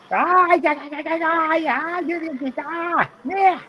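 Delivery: very high breathy yeahs; high squeaky girl voice. Retold or imitated. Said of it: "a Thai girl saying".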